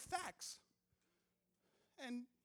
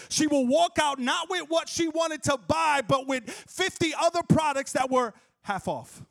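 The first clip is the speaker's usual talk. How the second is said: much louder, rolling sermon cadence; preaching at full force; 3 semitones higher, 13 dB louder than usual. Acted. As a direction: preach it to the congregation. Preacher-style building rant on shopping.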